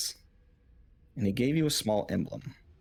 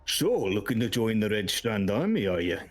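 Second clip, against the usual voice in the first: orc appraising a recruit; put-on orc character voice; grizzled orc. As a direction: look over a recruit as a battle-weary orc. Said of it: "He sounds".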